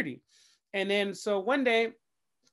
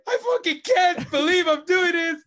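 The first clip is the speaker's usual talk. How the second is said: higher and louder, shouting through laughing tears; frustrated rant; 8 semitones higher, 6 dB louder than usual. Retold or imitated. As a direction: rant in the car, half laughing, half crying. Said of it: "and I'm just like".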